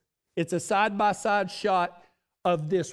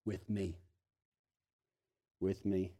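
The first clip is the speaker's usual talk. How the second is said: hushed emphasis; 13 semitones lower, 11 dB quieter than usual. Shown as a demonstration. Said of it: "the most beautiful words".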